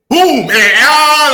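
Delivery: loud, high, drawn-out boom; explosive re-enactment. Retold or imitated. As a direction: burst out like a sudden attack. Acting out the sudden attack.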